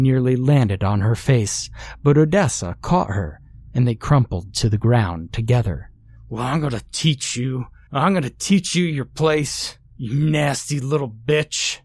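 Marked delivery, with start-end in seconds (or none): 6.3-11.8 menacing father, softer than a shout; spat. A narrator's reading. Angry drunk father threatens his daughter, spitting the words. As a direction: spit it out as a threat without raising volume. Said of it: "he spat".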